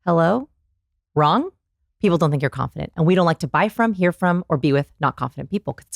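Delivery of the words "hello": "Hello" rising at the end like a question; question inflection. Shown as a demonstration. Showing how not to open, questioning rise. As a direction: lift the end of "Hello" unsurely. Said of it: "if you say".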